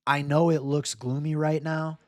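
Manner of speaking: ordinary talk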